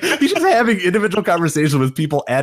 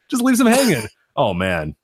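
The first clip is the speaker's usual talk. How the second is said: ordinary delivery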